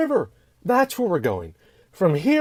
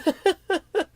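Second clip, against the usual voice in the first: sheepish laugh. Acted. Harper laughs off getting lost.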